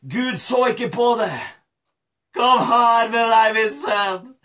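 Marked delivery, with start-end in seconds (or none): 2.4-4.3 emotional, loving father's welcome; forgiving father. Acted. Father forgiving his dirty son, emotional welcome. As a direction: draw out the welcome with feeling.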